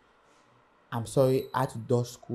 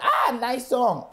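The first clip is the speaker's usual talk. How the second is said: impressed DJ; 11 semitones higher, 3 dB louder, much slower than usual. Retold or imitated. DJ reacting to the CD.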